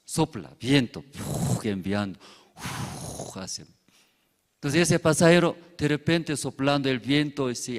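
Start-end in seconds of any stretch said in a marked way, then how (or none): 1.0-1.7 unvoiced puff of wind; blowing wind puff. Preacher acting the cloud's wind: soft blown puff. 2.6-3.4 soft wind puff. Acted. Cloud blows wind.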